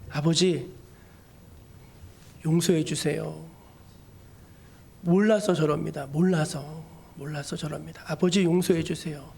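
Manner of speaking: low, subdued prayer with long pauses; tender plea. Acted. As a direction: plead gently for their forgiveness. Acting Jesus praying on the cross.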